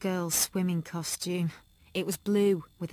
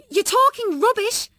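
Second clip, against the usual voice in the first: high, loud angry yell; shout; 10 dB louder than usual. A narrator's reading. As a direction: shout back at the bullies. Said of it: "I shouted".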